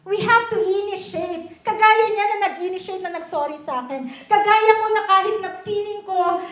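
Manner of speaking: loud, high preaching at full force; a fervent altar-call exhortation. Real, not acted. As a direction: exhort the congregation, building fervor. Closing exhortation to the congregation.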